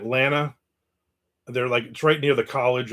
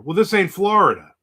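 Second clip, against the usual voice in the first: louder, higher mock indignation; indignant counterman; 6 semitones higher, 6 dB louder than usual. Counterman retorting to the Florida tourist, louder mock indignation. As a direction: snap back like a fed-up local. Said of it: "He was very upset".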